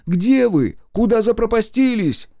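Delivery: high, loud frightened calling. A narrator's reading. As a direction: call out high and loud into darkness. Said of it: "позвала я".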